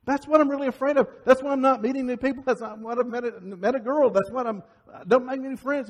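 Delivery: high, insistent run of reasons; acted agitated realization. Man realizing why he avoids people, agitated. Acting out man's fearful realization.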